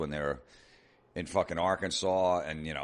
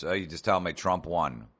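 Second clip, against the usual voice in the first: ordinary talk, nothing marked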